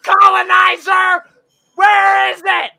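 high, loud, slow furious yelling; mock angry shouting. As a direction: scream it like an angry protester. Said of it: "they were just like".

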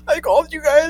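high, tearful fake blubbering; mock crying. Kid answering officers in the car, mock crying. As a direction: imitate being too upset to talk. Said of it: "I couldn't even talk".

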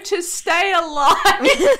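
cracking up mid-line; laughing. Real, not acted. Answers while laughing.

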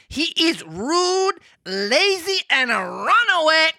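high, slow, drawn-out cricket voice; talking cricket voice. Acted. Reading Pinocchio aloud, the cricket speaks in a high voice. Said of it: "that voice is too good".

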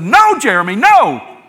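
sharp, high frightened yell; loud panicked no. Retold or imitated. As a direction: shout to stop a child in danger. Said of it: "it scared me so bad I yelled at him".

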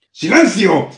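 loud, high demon roar; roaring command. Acted. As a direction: bellow for silence. Demon silences Hannah.

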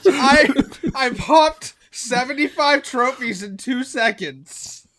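slow, laughing boast. Real, not acted. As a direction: laugh through the brag.

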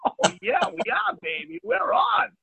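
high, thrilled yelling with a laugh; excited whoop. Retold or imitated. Captain cheering to the crew as the rod bends, high excited shout. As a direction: cheer like the big one just hit. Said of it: "I'm like".

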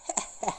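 short wicked witch cackle. Acted. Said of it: "cackled the Witch Queen".

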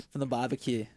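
lightly put-on Aussie accent; joky hint of Aussie; Australian accent. Shown as a demonstration. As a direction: say it like a mock Australian. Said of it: "I wanna hear your Australian accent".

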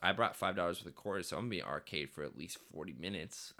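ordinary talk

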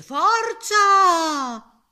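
high, very loud drawn-out cry; mighty shout. A narrator's reading. Wolf psyching himself up to blow the house down, shouting. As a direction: belt it out as one long rallying shout.

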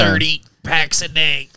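slow, mock raspy smoker lady; smoker's voice. Lady in old commercial confessing, slow imitated smoker rasp. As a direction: drag it out in a rasp. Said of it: "the old commercial of the lady".

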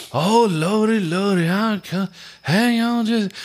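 put-on very high, drawn-out old-time maid voice; put-on mammy voice. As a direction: sing it out like a movie housemaid overplaying it. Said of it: "she was super putting on the, the fucking mammy thing".

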